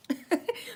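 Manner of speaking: short, soft chuckle; real chuckle. Real, not acted. Vlogger owning up to her accent slipping out, soft chuckle. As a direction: chuckle softly at your own slip.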